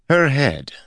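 high, doubting echo; skeptical. A narrator's reading. Guard mocking his lord's demand, skeptical. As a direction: throw the words back in disbelief. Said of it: "Ares' skeptical voice".

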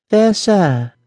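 a distressed princess greeting a stranger. Acted. Princess answering the prince, high and maidenly.

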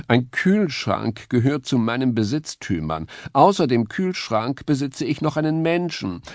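lightly voiced vain cat; smug pampered cat. A narrator's reading. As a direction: show off your possessions. Musketeer character voice.

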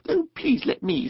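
very high little mole plea; eager pleading. A narrator's reading. Mole asking rat to let him pack, pleading. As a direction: beg sweetly in a small, high voice.

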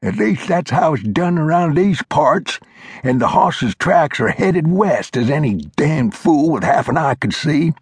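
put-on high, reedy old-timer lecturing; old mountain man voice. A narrator's reading. Old Glover scolding Flintlock, high old-timer voice. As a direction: play the cranky old coot setting him straight.

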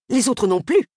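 ordinary delivery

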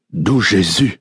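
a touch of a startled outburst; startled exclamation. A narrator's reading. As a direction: blurt it out in alarm. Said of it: "Mazan ejaculated".

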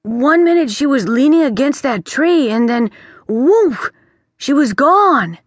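put-on a young prince rattled and insistent; anxious boy prince. Prince panics about missing girl.

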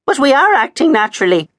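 high, loud and fast protest; agitated objection. A narrator's reading. Maud protests to Miss Maple, high and agitated. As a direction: object in a rush, alarmed. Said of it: "objected Maud".

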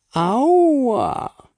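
high, loud yelp of pain; a chocolate star yelping after her fall; pained ouch. Acted. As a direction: cry out as if bumped. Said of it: "stöhnte sie".

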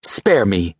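usual speaking voice

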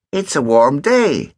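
put-on lazy teasing kid; cheeky boy voice. Ogden replies.

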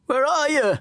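very high, cracking shout; voice breaking. A narrator's reading. Wounded kid calling for his mate, voice breaking. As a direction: call out high till the voice breaks. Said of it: "My voice breaks".

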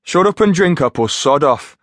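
high bossy Geordie jibe; cheeky Geordie order; Geordie accent. Acted. Finch bossing his mate.

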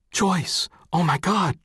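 high-pitched exclamation of a startled colleague; crying out. A narrator's reading. Colleague cries out to Joyce on remembering her husband, high-pitched exclamation. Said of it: "cried a colleague".

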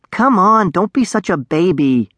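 high-pitched opening, easing down into persuasion; coaxing. A narrator's reading. Darek to the hiding dragonling, coaxing. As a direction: cajole it, starting high. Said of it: "Darek coaxed".

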